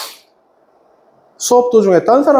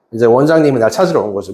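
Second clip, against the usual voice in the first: normal talking voice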